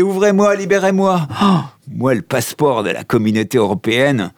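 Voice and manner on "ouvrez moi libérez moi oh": high-pitched mock cry to be let out; mock yell. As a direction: yell high as if locked in, half-joking. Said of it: "Mon boss criait, frappait". (imitation aiguë du patron qui crie)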